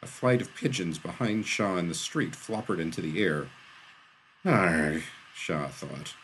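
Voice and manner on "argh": loud groan of dismay; exasperated groan. A narrator's reading. Shaw curses the timing in thought, exasperated groan. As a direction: groan it out in frustration.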